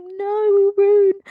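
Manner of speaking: high, loud wail of no; anguished cry. Real, not acted. Fan crying out Rune's name in protest. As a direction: cry out his name in dismay. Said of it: "And I'm like".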